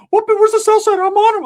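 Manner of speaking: very high, loud squeaky friend voice; Ricky's squeaky voice. Retold or imitated. Hyped friend squealing to the narrator, very high and loud. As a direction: pitch it way up and squeal it out. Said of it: "Ricky's like".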